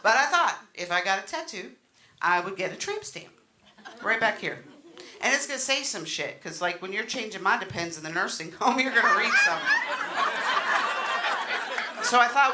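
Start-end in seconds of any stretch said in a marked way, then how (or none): none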